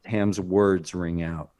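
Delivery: ordinary delivery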